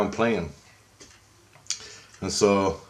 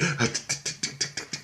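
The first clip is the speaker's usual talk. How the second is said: soft, rapid tongue-click pitter-patter; imitated running footsteps; 5 semitones higher, 8 dB quieter than usual. Daughter runs away down the hall, imitated ticking footsteps. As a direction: tick out little running feet with the mouth. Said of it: "Her feet".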